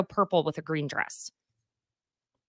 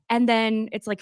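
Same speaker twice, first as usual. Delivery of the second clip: ordinary delivery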